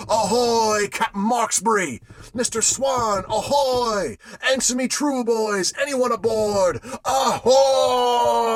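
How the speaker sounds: loud, high call across the water; hailing call. A narrator's reading. Captain calling to the silent ship, loud and high. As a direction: shout to a distant ship, pleading for answer.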